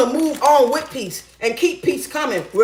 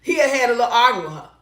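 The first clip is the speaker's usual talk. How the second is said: normal talking voice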